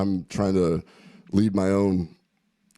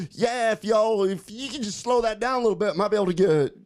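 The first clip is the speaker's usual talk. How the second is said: much higher, hammed-up Arkansas accent; Arkansas accent imitation; 11 semitones higher than usual. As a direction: squeeze it up high like a good-natured Arkansas guy asking a favor. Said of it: "a good old Little Rock, Arkansas accent".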